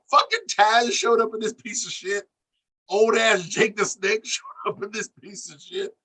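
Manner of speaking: high, disbelieving rant with a laugh; incredulous laughing rant. Real, not acted. Host mocks who showed up in the promo, high and amused.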